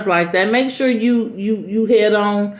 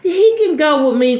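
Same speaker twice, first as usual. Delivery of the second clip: much higher, sugary offer; fake-sweet babysitter; 9 semitones higher, 4 dB louder than usual. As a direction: put on a sweet helpful voice. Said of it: "she was like".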